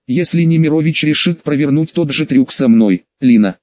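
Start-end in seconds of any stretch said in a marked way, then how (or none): 3.2-3.6 calling out. A narrator's reading. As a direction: call her name sharply. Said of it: "крикнул я".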